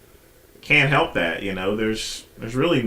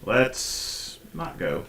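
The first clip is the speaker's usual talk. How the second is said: deadpan mock; 6 dB quieter, much slower than usual. Real, not acted. Mocking reply to Let's go.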